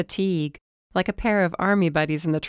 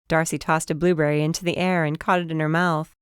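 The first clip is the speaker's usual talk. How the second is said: plain reading voice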